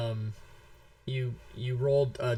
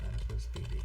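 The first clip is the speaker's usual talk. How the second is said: breathy whispered name; 8 dB quieter, faster than usual. Real, not acted. Host typing the winner's name, whispered aside. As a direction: whisper it to yourself while typing.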